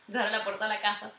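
ordinary talk

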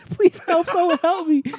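very high, loud fake wail; teasing squeal of a crying victim; mock crying plea. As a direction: whimper a hammed-up cry for help. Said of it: "You crying".

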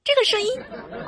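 high, fast, amazed squeal; surprised squeal. Real, not acted. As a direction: shriek with delight at his voice. Reacts to guest's deep voice.

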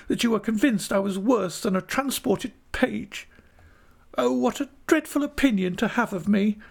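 very high, tearful reproach; melodramatic, high-pitched acted sobbing; sobbing wife. A narrator's reading. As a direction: wail at the husband in a high little voice. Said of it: "sobbed Dora".

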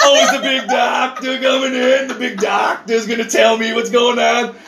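high, loopy mock shouting; drugged kid. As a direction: imitate a doped-up kid babbling about his doctor. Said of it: "And he's just go".

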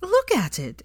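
high-pitched, emphatic exclamation; exclaiming. A narrator's reading. Hermione to Ron about his jumper, high exclamation. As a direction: exclaim it with pitch leaping right up. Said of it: "exclaimed Hermione".

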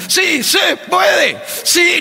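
high, slow, loud stadium cheer; cheering shout. Retold or imitated. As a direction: yell it like a rally chant. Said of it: "la otra grita".

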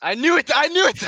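loud, high, gleeful; triumphant shout. Real, not acted. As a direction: crow it out in triumph.

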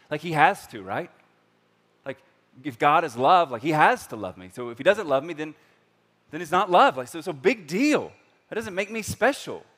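loud, high, exasperated reasoning; mock indignant. Preacher voicing a jaded churchgoer, loud mock exasperation. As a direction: argue it like it's obvious.

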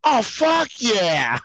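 put-on slow slurred high drunk yes. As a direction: drag it out, sloshed. Drunk admits being drunk.

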